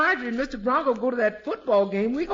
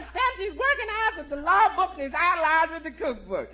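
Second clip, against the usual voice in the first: gleeful shout; 6 semitones higher than usual. Acted. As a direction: shout the punchline with delight. Cook shouts punchline third time.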